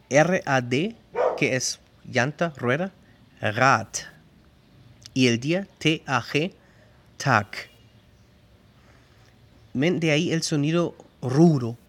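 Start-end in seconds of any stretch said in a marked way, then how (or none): 3.4-3.9 "Rad" ending in a hard t; hardened final D. Shown as a demonstration. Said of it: "la D se pronuncia T". 7.2-7.5 "Tag" ending in a hard k; hardened final G. Shown as a demonstration. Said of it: "la G como una K".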